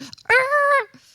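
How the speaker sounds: high loud sudden yelp; mock panic yelp. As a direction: jolt out a startled cry. Acting panic attack hit.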